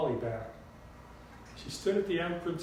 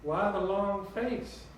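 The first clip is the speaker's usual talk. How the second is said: higher, sugary Southern drawl; sweet Southern drawl; 5 semitones higher, slower than usual. A narrator's reading. Grandmother greeting Claire, sweet Southern drawl. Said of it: "in her sweet southern drawl".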